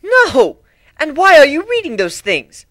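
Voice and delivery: high, loud, appalled outburst; disturbed Rarity. Acted. Rarity appalled at Pinkie's comic-book guess, high and loud. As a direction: recoil in horror at the idea. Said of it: "Rarity asked, disturbed by the mental image".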